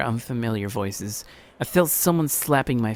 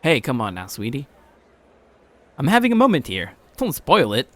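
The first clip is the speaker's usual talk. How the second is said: a hint of a bystander, louder; lightly voiced gruff man; 2 semitones higher, 4 dB louder than usual. A man at the station checking on the fallen boy.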